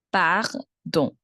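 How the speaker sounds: Pardon split slowly into par, then don; syllable by syllable. Shown as a demonstration. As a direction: pause between par and nasal don. Teacher breaks word in two.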